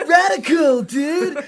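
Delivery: high, loud laughing surfer voice; surfer dude. Pretend. Joining in mocking the stoner, hammed-up surfer. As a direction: play it like a dopey beach bro.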